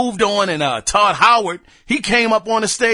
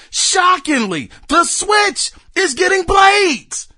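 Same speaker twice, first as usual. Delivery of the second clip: excited; 5 semitones higher, slower than usual. Real, not acted. Hyped about Switch announcement.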